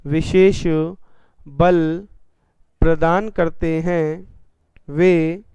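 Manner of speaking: slow, word-by-word dictation. Shown as a demonstration. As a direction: dictate it slowly for notes. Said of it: "परिभाषा लिखेंगे". (धीरे-धीरे बोलकर लिखवाना)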